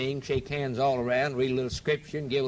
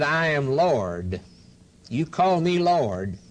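slow solemn; slower than usual. Acted. Jesus quoted slowly.

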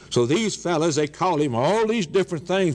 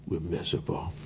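hushed; 9 semitones lower, 8 dB quieter, faster than usual. Acted. Preacher's dropped-voice conclusion.

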